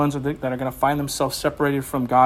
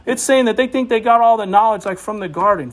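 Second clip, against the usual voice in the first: ordinary talk, nothing marked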